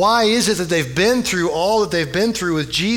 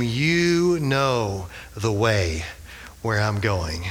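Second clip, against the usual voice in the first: slow, low, peaceful reassurance; comforting; 8 semitones lower, 5 dB quieter, slower than usual. Acted. Jesus to his disciples at the close, comforting. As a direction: soothe them, unhurried and softening. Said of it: "saying comforting, peaceful things to them".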